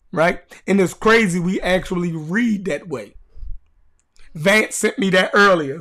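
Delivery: usual speaking voice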